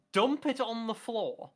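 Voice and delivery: high, drawn-out incredulous question; baffled disbelief. Real, not acted. Baffled repeat of the wording.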